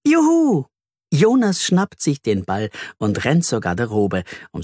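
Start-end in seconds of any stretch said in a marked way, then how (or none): 0.1-0.7 high bright whoop of joy; a child cheers as they rush out to play football, joyful whoop; cheering child. A narrator's reading.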